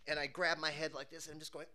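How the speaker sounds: ordinary talk, nothing marked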